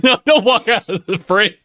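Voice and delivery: high, through laughter; laughing plea. Real, not acted. Co-host begging host to stay on camera, laughing. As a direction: plead while cracking up.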